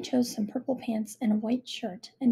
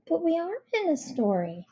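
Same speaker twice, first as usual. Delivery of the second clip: put-on high little girl voice; 7 semitones higher than usual. A narrator's reading. Girl points out.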